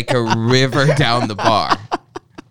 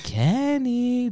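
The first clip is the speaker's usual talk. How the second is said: high drawn-out call of Kenny in a mock Chinese accent; a teasing, sing-song Chinese-accent call of Kenny; 13 semitones higher, 3 dB quieter than usual. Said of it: "Again, Chinese accent".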